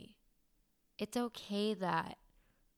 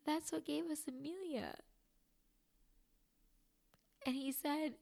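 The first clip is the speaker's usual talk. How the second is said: crying; 7 semitones higher than usual. Real, not acted. Retelling husband's loving words about her scar, crying. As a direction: voice breaking high through real tears. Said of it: "I'm officially crying".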